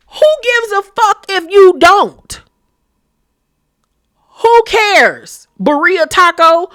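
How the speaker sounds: high, loud scornful dismissal; mocking rant. Host mocking Denise with nicknames, high scornful rant. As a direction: sneer nobody cares at her.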